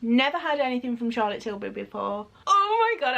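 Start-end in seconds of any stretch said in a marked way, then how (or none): 2.5-3.2 high, loud happy cry; overjoyed squeal. Real, not acted. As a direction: burst out with joy. Overjoyed at gift.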